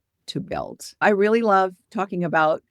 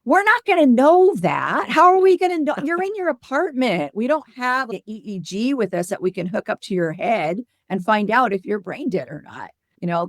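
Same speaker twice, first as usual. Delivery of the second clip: exasperated disbelief; 4 semitones higher than usual. Retold or imitated. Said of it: "And I was like".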